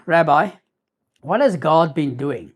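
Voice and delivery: normal talking voice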